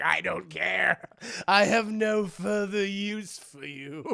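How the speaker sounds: slow, deliberate villain voice; cold villain. Retold or imitated. Esther dismissing Max, slow villain voice then a cackle.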